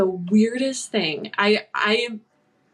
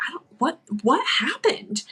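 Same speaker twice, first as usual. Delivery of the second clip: higher, halting retold question; bewildered, pitched-up asking; very confused; 5 semitones higher than usual. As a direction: stumble into a puzzled question. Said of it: "very confused".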